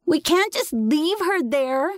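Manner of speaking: put-on very high, drawn-out child's cry; little boy screaming. A narrator's reading. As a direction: wail in protest, slow and high. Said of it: "he screamed at me".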